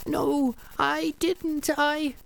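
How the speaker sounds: high, slow, tearful begging to a ghost; high tearful pleading to Kazuki; pleading woman. Acted. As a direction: beg forgiveness with a high trembling voice. Protagonist denies.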